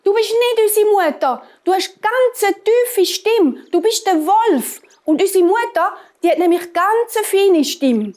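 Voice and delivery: put-on high, indignant little kids; high-voiced kids shouting through the door; goat children calling out. A narrator's reading. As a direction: pitch it way up and accuse the wolf. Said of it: "haben die Kinder gerufen".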